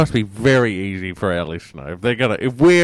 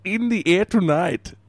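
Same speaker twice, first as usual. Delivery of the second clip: light mock Italian-German mobster accent; a hint of a thick foreign accent, deadpan; put-on Godfather accent; 10 semitones higher than usual. Caller plays a Godfather, mild mock foreign accent. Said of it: "it's probably my accent".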